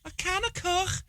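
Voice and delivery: squeaky-high, soft Scouse caricature; Scouse accent. Shown as a demonstration. Showing all he knows of Scouse, high exaggerated Scouse. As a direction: exaggerate Scouse with a throaty final k. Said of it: "All I know about Scouse".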